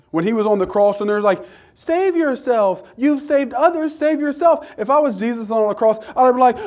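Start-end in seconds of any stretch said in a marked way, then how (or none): 1.7-4.7 higher, mocking jeer from a crowd; reviling taunt. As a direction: taunt him as an onlooker would. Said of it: "When they were reviling him".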